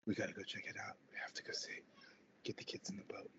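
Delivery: urgent whisper; hushed command. Acted. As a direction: hiss the orders without waking anyone. Spouse sends family off secretly.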